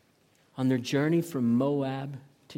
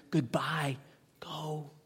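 whispered, emotional parting words; hushed farewell; 4 dB quieter, slower than usual. Acted. Naomi parts from her daughters-in-law, hushed whisper. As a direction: whisper it as a painful send-off.

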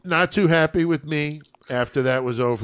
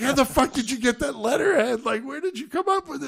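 high-pitched, frantic imitation; freaking out; 11 semitones higher than usual. Tom demands where the letterhead came from, high mock freak-out. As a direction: act out a baffled victim in a high voice. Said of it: "Tom is freaking the fuck out".

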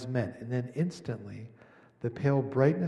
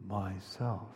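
"myself" breathed much softer, a hushed whisper; whispered softly; 6 semitones lower, 8 dB quieter than usual. A narrator's reading. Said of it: "whispered so softly you could hardly hear it".

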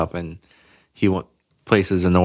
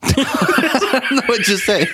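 laughing through the words; giggling while talking; 14 semitones higher, slower than usual. Real, not acted. Laughing after friend's remark.

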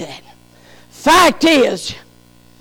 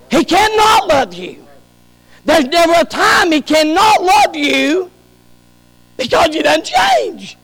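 usual speaking voice

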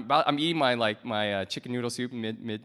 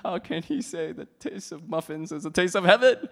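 higher, louder scandalised listener; mock outrage; 6 semitones higher, 7 dB louder than usual. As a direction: imitate an appalled critic, rising at the end. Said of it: "Some of you are like".

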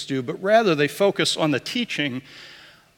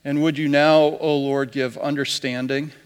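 prayer. Acted. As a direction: lead the congregation in prayer, unhurried.

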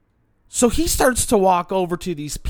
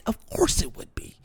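scornful aside; 3 semitones higher, 7 dB quieter than usual. Real, not acted.

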